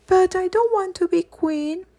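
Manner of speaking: put-on a small girl refusing her fate; little girl protesting. A narrator's reading. Young Victoria protests to governess.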